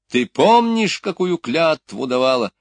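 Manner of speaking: loud, high-pitched challenge; stern demand. A narrator's reading. Строгий вопрос о клятве. (громкий требовательный вопрос)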